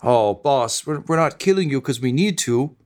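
enthralled friend mocking the hero; gleeful villain. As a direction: start sweet, end shrieking with glee. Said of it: "smiles and says".

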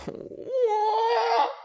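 high squealing laugh close to tears; embarrassed laugh-cry. Real, not acted.

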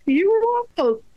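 very high, loud delighted echo; squealed amazed mom reply; mom's amazed reply. Retold or imitated. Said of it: "I'm like".

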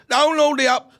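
high, loud bad Geordie; attempted Geordie accent. Shown as a demonstration. Guest retries the sponsor line, attempted Geordie accent. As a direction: have another loud go at Geordie. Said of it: "in a Geordie accent".

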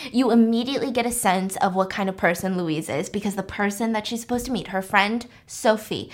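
ordinary delivery

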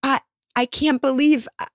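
a hint of a fond, fed-up spouse; exasperated husband. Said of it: "he looks at me like".

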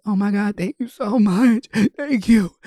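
high, breathless thanks from a relieved mom; imitating a relieved mother; grateful mother. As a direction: gush thanks at the stranger.

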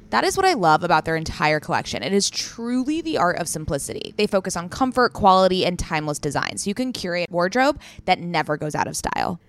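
loud, punchy scripted endorsement; ad read. Real, not acted. Host praising the collection to listeners, loud ad read. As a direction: push each selling point with emphasis. Reads a sweater ad.

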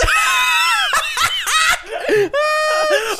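loud, high shrieking laugh; scream-laugh out of control; hysterical laughing. Real, not acted. Hysterical laugh at friend's pain.

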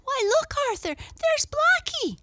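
put-on very high, loud excited girl; girl crying out. A narrator's reading. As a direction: call out shrilly to a brother, thrilled. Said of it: "cried Mabel".

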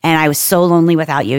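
normal talking voice

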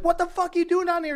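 high, fast mock guard bark; mock guard challenge. Retelling guards catching the dad, high fast mock demand. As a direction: play the guard catching an intruder. Security guards confronting him.

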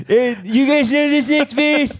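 very high, slow, dopey voice; high and dragged, like a dim singer; dopey pop star voice. Pretend. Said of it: "He's like".

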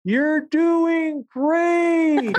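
high, slow, far-off calling voice; slow, stretched call from far away with a laugh in it; distant call. Retold or imitated. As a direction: call it out from across a huge train station. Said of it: "like someone calling at you from the other end of Grand Central Station".

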